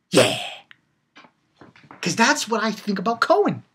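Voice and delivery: usual speaking voice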